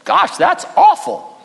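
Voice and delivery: very high, slow cartoon bunny voice; squeaky little rabbit, disgusted; high drawn-out bunny dismay; Thumper. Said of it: "Thumper says".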